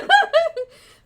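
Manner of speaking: loud, high cackling laugh; real burst of laughter. Real, not acted. Player laughing at own joke, loud and high. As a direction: crack up out loud. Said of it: "No, I'm just kidding".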